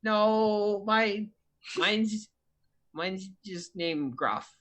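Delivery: lightly voiced timid child, pausing often; shy kid Timmy. Shy Timmy answers his buddy.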